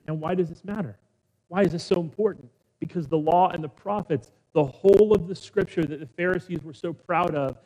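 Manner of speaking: normal talking voice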